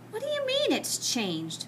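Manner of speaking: high, sharp demand from a teacher; a teacher demanding an answer. Acted. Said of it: "Miss Plimsoll demanded".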